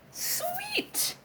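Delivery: very high "Sweet", soft; excited squeal. Acted. Roommate thrilled to borrow a vehicle, high soft squeal. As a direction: squeak it out with glee. Pleased at permission.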